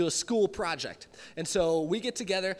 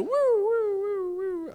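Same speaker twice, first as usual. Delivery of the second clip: high wailing woo-woo of a siren; police siren imitation; 12 semitones higher, 5 dB louder, much slower than usual. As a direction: imitate the cop siren behind you. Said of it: "all of a sudden".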